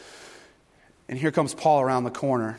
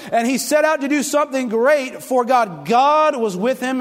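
loud, high, fervent preaching; full-force preaching; 7 semitones higher, 3 dB louder than usual. Acted. Pastor preaching fervently to the congregation, full force.

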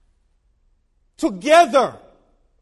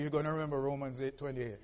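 ordinary talk

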